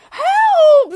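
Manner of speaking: shrill scream for help, very high and loud. A narrator's reading. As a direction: shriek it in panic. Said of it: "screamed Missus Twit".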